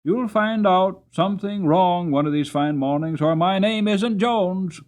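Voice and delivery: a knowing warning from a wise cabbie; warning cabman. Acted.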